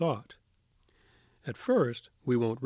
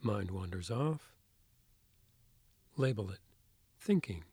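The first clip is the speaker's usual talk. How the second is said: soft spa whisper; 4 dB quieter than usual. Acted. Leads a guided meditation.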